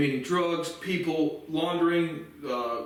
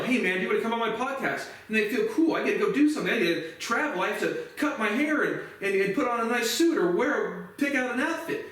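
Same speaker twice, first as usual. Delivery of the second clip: much higher, giddy mock guest voice; mock excitement; 7 semitones higher than usual. Mocking podcast guests thrilled by an invite, in a high giddy voice. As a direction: ham up the eager podcast guest.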